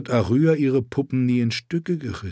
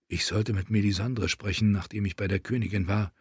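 softer, thinking to himself; inner thought; 7 dB quieter than usual. A narrator's reading. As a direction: voice a private thought softly. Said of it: "dachte er". (leise, in Gedanken)

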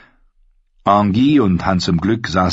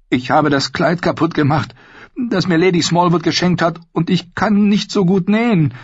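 put-on high, girlish Arya voice; young girl voice; 10 semitones higher than usual. A narrator's reading. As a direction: voice her as a young girl, much higher.

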